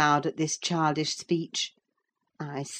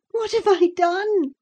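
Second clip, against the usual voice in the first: put-on much higher, louder tearful girl's cry; sobbing; 5 dB louder than usual. A narrator's reading. Cathy hurt by the scolding, sobbing. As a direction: sob it out as a hurt child. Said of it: "sobbed she".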